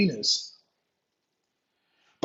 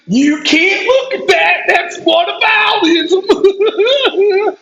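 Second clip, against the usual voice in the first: mock outraged shout; 9 semitones higher, much slower than usual. Mocking the opponent's argument.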